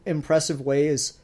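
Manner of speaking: ordinary talk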